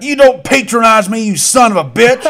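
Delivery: loud, high hammed-up angry shout; mock angry yell. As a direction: yell it back in mock outrage. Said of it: "beer man yells back".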